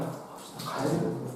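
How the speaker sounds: ordinary delivery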